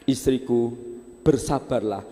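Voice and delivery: low, gentle reassurance; calm husband. Acted. Husband calms wife, low and gentle.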